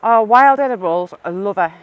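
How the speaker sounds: loud burst of delight on "wild edibles". Real, not acted. Reacting to a viewer's wild food cooking, loud and delighted. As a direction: gush with real enthusiasm.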